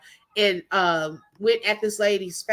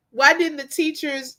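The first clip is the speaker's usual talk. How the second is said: normal talking voice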